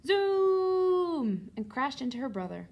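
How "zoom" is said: loud, high, drawn-out zoom; speeding zoom. A narrator's reading. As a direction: imitate a chair racing past. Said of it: "went really fast".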